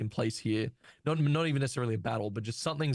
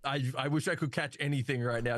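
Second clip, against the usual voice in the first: ordinary talk, nothing marked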